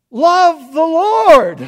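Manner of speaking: loud, slow cry of Love the Lord; slow proclaimed call. Acted. Preacher crying out David's words to the saints, loud and slow. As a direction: call it out to the whole congregation.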